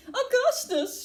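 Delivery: high-pitched motherly shout; high alarmed call. A narrator's reading. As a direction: call his name out high and sharp. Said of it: "shouted Missus Gloop".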